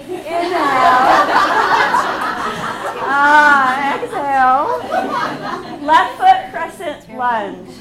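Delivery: giggling while calling poses; cracking up mid-class; laughing through cues. Real, not acted.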